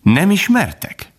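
high, slow question. A narrator's reading. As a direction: ask it high and drawn out. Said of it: "Kis szünet után kérdezte".

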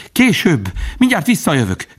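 very high, urgent plea; begging. A narrator's reading. Bambi begs leave of the old stag, pleading. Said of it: "könyörgött".

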